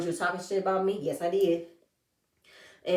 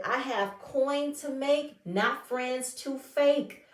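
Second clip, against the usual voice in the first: slow, higher, deliberate mic-drop delivery; dramatic exit line; 6 semitones higher, much slower than usual. Retold or imitated. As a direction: drag out each word like a parting shot. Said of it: "she walks out and said".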